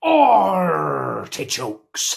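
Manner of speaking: stretching the arrr in Artichokes very slowly; milk the pirate pun, Artichokes dragged out; drawn-out punchline. Acted.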